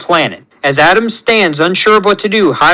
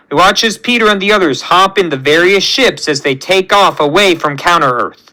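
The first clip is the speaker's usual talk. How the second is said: plain reading voice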